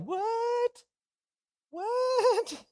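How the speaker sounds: very high squeaky confused what; dazed Paul. Acted. Paul coming back to life, hammed-up squeaky confusion. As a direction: wake up baffled, voice shooting up.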